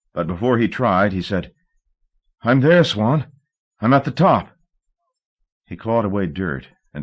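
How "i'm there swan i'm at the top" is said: loud, high call upward. A narrator's reading. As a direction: call out to her above. Calling up to Swan.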